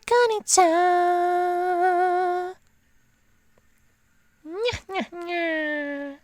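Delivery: high anime kitten voice; cat girl voice. Acted. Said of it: "that's enough being a derp".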